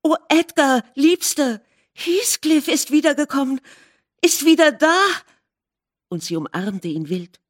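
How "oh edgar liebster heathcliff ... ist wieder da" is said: high, eager rush of joy; overjoyed. A narrator's reading. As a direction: burst with the news, rising higher. Wife overjoyed, embracing husband.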